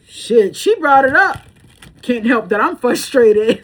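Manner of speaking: lightly voiced exasperated husband; frustrated husband. Husband snapping back at the mom, frustrated.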